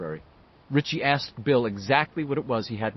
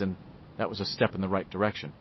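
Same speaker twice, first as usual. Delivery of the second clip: plain reading voice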